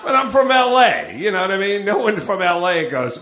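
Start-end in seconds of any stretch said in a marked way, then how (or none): none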